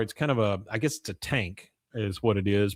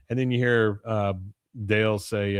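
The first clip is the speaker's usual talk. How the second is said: normal talking voice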